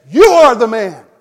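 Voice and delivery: loud, high pointed accusation; stern accusation. Acted. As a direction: nail him with it, full force. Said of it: "I'm sure he said it in that tone of voice".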